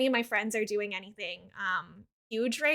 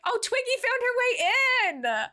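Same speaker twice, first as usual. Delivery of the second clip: excited; 9 semitones higher, 3 dB louder than usual. Real, not acted. Sees pet arrive on stream.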